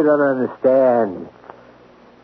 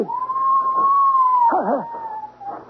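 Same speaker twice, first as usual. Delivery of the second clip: high, shrieking mad laugh; maniacal laugh. Acted. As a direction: laugh like a madman. Madman's laugh.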